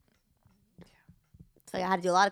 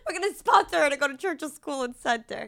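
laughing; 5 semitones higher than usual. Retold or imitated. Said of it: "They're like".

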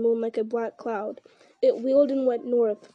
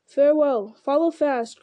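called farewell; 3 semitones higher, 5 dB louder than usual. A narrator's reading. Wizard calling farewell to friends, loud parting cry. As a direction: call it out as he rides off. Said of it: "cried Gandalf".

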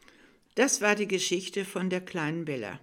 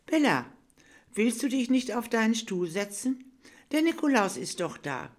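higher, louder coaxing teacher voice; kind teacher; 6 semitones higher, 6 dB louder than usual. Acted. Teacher coaxing shy Bella to sit, higher and louder. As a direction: coax the shy child gently but brightly.

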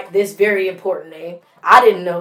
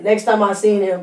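ordinary talk, nothing marked

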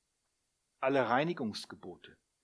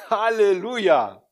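joyful halleluja; 5 semitones higher, 13 dB louder than usual. Real, not acted. Joyful exclamation after climax.

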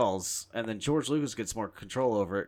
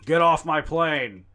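slow, louder gruff Harrison Ford growl; Harrison Ford impression; 4 semitones higher, 9 dB louder, slower than usual. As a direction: drag it out like an action hero. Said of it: "That's the Harrison Ford impression".

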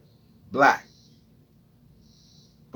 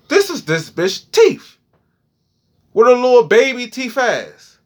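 high excited realization; 11 semitones higher, 6 dB louder than usual. Retold or imitated. Realization quote.

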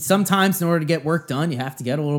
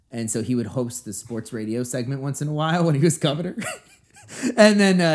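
normal talking voice

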